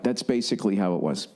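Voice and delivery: usual speaking voice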